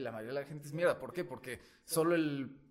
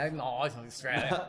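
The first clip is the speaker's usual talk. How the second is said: put-on Australian accent; 3 semitones lower than usual. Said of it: "con acento australiano".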